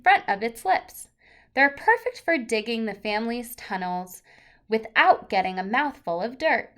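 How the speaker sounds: lively picture-book read-aloud; reading to children. Acted. Teacher reading a book to the class, lively and clear.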